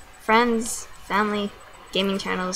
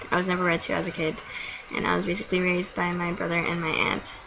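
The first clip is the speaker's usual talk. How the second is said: usual speaking voice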